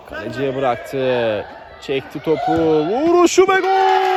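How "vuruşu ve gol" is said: screaming goal call. Real, not acted. Goal call in live commentary.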